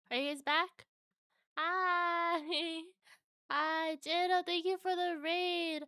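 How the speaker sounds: bright, high, excited greeting; cute anime streamer. Real, not acted. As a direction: squeal a welcome to the raiders. Stream returns, greeting the raiders.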